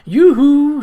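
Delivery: loud, high yoo-hoo across a platform; cheerful cry. A narrator's reading. Young lady hails her brother across the platform, loud and high. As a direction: sing it out to catch their eye. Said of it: "she cried, waving".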